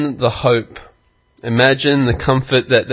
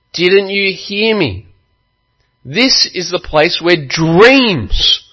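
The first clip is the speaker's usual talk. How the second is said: higher, pressing warning voice; insistent soldier; 7 semitones higher, 4 dB louder than usual. Retold or imitated. Soldier pressing the sailors to hear his warning. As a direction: urge them, almost pleading.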